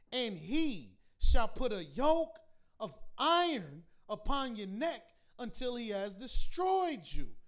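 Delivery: normal talking voice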